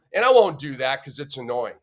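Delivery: ordinary talk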